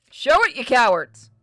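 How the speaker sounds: loud, high taunting yell; shouting. Real, not acted. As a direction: yell it out, demanding. Yelling at the game.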